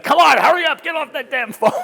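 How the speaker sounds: loud, high hurry-up shout; impatient yelling. Retold or imitated. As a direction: bark at the guy on the phone. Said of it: "and everyone like".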